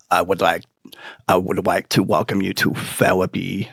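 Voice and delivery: a lightly done Fudd-style therapist; Elmer Fudd voice. Retold or imitated. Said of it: "She talked like Elmer Fudd".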